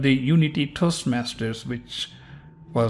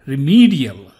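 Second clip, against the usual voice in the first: remedial, ME punched louder; stressed middle E; 6 dB louder than usual. Shown as a demonstration. Teacher repeating for learners, remedial stressed on the middle E. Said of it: "the middle E is stressed".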